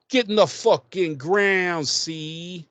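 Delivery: hammed-up tough-guy order; mock tough cop. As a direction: bark it like an old film tough guy. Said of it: "she's like".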